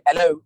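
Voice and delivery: "hello" with the h dropped; regional hello. Shown as a demonstration. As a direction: say "hello" English-style, starting straight on the e. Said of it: "sometimes people say".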